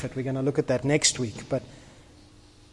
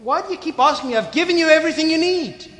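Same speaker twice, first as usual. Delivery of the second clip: much higher, louder exasperated voice of God; 11 semitones higher, 4 dB louder, faster than usual. Pretend. Preacher voices God answering people who keep asking, much higher and exasperated. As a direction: play God fed up with being asked. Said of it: "God looks at us and goes".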